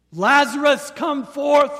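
ringing shout of command; loud cry. Retold or imitated. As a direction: call a dead man out of the tomb. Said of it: "it's a loud cry".

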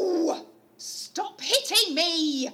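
loud slow cry of pain; pained shout. Acted. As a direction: yell at them to stop. Being hit in acted fight.

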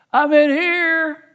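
put-on high, loud, drawn-out child's call; hiding toddler. Small child calls to the seeker from hiding, high and eager to be found. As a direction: call out slowly in a high little-kid voice.

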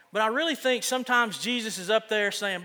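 ordinary delivery

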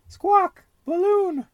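very high, loud parrot call; parrot squawk. Acted. Polly the parrot calls after the balloon, high and loud. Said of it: "says Polly Parrot".

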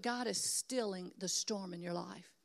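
ordinary talk, nothing marked

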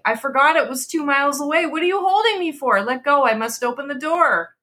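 high, rushed, desperate pleas; frantic pleading. A narrator's reading. Wife struggling against her husband's grip, high frantic pleading. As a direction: fight your way to the door with your voice.

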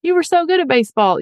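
high, pushy disbelief; a mock dismayed adult who asks why; shocked prying reaction. Shown as a demonstration. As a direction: sound dismayed at the quitting. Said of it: "it's like this".

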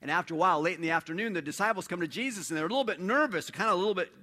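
usual speaking voice

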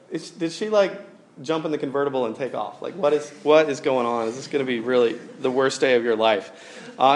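usual speaking voice

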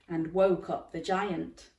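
ordinary delivery, nothing marked

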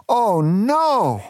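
high, dramatic cry of dismay. Acted. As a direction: exclaim in storybook alarm. Narrator acts dismayed reaction to rockslide.

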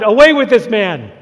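loud shout of a crowd. A narrator's reading. Preacher voicing the crowd's loud cry. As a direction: shout it like an angry mob. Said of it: "the whole crowd shouted".

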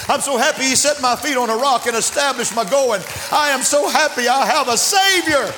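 high-pitched testifying that builds to a shout; fervent preaching. Real, not acted. Preacher telling the church he is saved, fervent and building to a shout. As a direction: pile up each repeated phrase, climbing to a shouted peak. Testifies at rising force to congregation.

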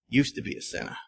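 a lightly imitated smug saint, half-laughing; smug holy man. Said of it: "he looked at me dead serious".

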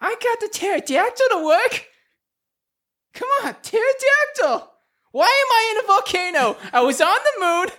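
very high, panicky granny voice; old Mildred. Acted. Grandma Ranger calling her grandson from a volcano, high and panicky. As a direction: cry for help like a frantic old lady. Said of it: "She's like".

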